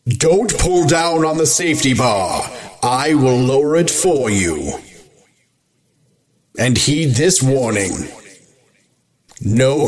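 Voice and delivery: slow, eerie ride narrator voice; lightly voiced ghost host; spooky ghost host. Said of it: "I can do this all night long".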